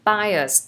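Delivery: Bias said as BYE-us, two syllables with a final s; pronounce bias. Shown as a demonstration. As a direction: say Bias as bye-us with a clear s at the end, not bia. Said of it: "từ này đọc là bias nhá, không phải là bia nhá".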